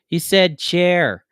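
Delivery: loud, high, drawn-out mockery; gleeful jeer. A narrator's reading. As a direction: crow it slowly at full volume. Furry gleefully mocks a word.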